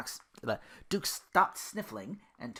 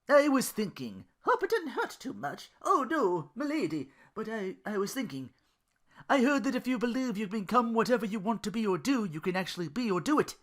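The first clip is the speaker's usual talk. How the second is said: higher character voices; lightly voiced banter between two characters; voiced dialogue; 10 semitones higher, 4 dB louder than usual. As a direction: trade lines as eager Duke and dry Belle. Duke and Belle dialogue voices.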